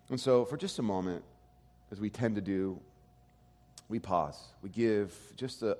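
soft, low, unhurried pastoral prayer. Real, not acted. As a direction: pray quietly and reverently to God. Pastor prays before the sermon.

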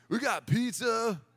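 high, slow dopey skater voice; skate camp guys. Retold or imitated. Skate guy boasts of camp food, in a high, drawn-out dude voice. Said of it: "this is her impression of these guys".

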